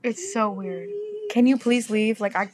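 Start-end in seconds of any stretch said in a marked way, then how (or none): none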